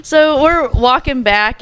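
normal talking voice

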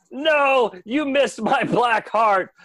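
high, loud, slow gloat; mocking villain. Insect King taunts Dean after losing his arm, high, loud and slow. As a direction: taunt him that he missed. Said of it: "the Insect King's like".

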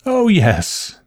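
high-pitched frightened agreement. A narrator's reading. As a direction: agree timidly in a high aunt's voice. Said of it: "fright pale in her eyes".

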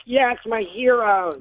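slow, awed fanboy voice; starstruck fan. Pretend. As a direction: gush at your heroes. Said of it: "I would be like".

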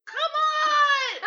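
mock-impatient yell, high and very loud. Granddaughter waiting on dawdling grandparents, impatient yell. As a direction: holler it in comic exasperation.

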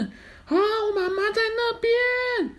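very high, slow joyful squeal; excited high cry. Acted. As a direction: squeal with joy at seeing mom. Lost child sees mother.

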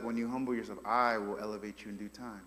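low, soft and calm; a gentle, reassuring promise; voice of Jesus. Retold or imitated. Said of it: "Jesus is like".

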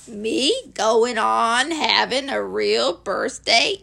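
very slow donkey voice, rising at the end; ponderous, doleful question; disbelieving gloomy Eeyore. Acted. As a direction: drag out each word in doubt.

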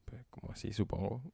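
unvoiced, puzzled whisper; baffled hushed aside. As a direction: whisper it, confused. Baffled reply in retold chat.

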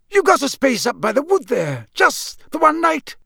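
high-pitched local campsite keeper; Mister Roberts. Acted. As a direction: check the booking in a high character voice. Mister Roberts checks the booking.

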